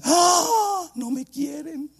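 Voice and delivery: high mock-wounded gasp and lament; dramatic girlfriend. Rejected girlfriend lamenting, high and melodramatic.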